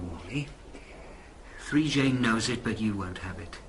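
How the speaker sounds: ordinary delivery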